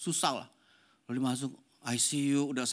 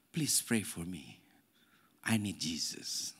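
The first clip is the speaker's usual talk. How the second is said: put-on quiet, slow, lower plea of a sick child; earnest small boy pleading; 7 semitones lower, 6 dB quieter, slower than usual. Sick grandson in ICU asking grandpa to pray, quiet slow plea. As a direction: ask softly, like a little boy who means it.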